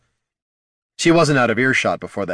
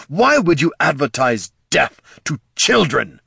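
demanding; 7 semitones higher, 5 dB louder than usual. Acted. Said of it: "demanded the Knight Commander".

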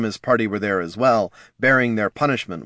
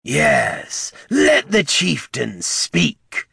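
foul-voiced cry; 6 semitones higher, much slower than usual. A narrator's reading. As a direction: jeer it in a foul goblin-like voice. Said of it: "cried a foul voice behind her".

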